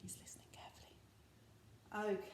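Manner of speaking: hushed, confidential whisper. Shown as a demonstration. Teacher telling children the wolf listens, whispering. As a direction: whisper it as a soft aside. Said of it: "Can you whisper to William Wolf?".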